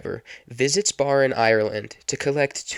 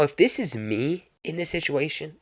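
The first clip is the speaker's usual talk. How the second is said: ordinary talk, nothing marked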